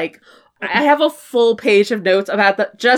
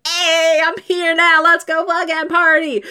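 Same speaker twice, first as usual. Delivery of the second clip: high, excited party-crasher voice; eager party friend; 7 semitones higher than usual. Acted. Friend turning up for a midnight Denny's run, high and excited. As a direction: announce yourself in a high, held-up call. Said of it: "you're just like".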